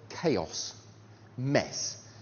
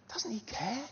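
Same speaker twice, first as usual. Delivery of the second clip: unvoiced anxious whisper; 7 semitones higher, 10 dB quieter than usual. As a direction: breathe it out like a scared disciple. Voicing disciples' fear.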